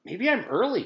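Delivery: put-on high, hopeful girl's voice; hopeful thought. A narrator's reading. Jenny hoping she is just early, high and light. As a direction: reassure yourself lightly. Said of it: "Jenny thought".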